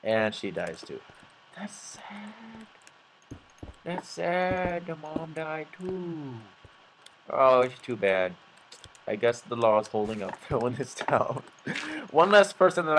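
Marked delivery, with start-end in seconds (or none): 1.6-2.7 very soft, high whisper; hushed mock mourning; mock-sad whisper. Mock mourning after acted deaths. 3.8-8.4 hushed, hammed-up sorrow; mock sadness. Mock mourning after acted deaths.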